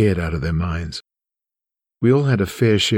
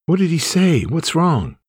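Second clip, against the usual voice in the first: alarmed wife; 9 semitones higher than usual. Retold or imitated. Wife woken by his crying.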